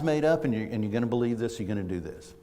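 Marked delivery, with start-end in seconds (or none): none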